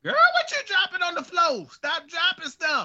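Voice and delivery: high, loud exclamation of disbelief; playful scolding. Real, not acted. Host scolds co-host for dropping stuff, high playful scolding. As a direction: call out a teasing telling-off.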